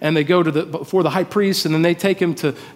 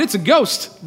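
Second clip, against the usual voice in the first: usual speaking voice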